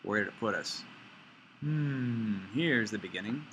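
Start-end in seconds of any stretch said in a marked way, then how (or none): none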